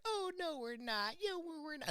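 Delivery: high, thin mock voice of a defensive woman; mocking operator. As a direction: mimic her as a prissy, defensive little voice. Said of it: "one chick was like".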